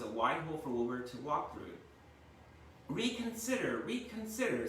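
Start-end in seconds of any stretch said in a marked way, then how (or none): 2.9-4.7 much higher pleading goose. A narrator's reading. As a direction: beg him to think again. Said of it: "said the goose".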